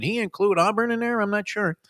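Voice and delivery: high-pitched mock-unsure voice; high, hesitant mock wondering; puzzled bettor act. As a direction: play a bettor squinting at the small print. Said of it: "trying to take a peek and go".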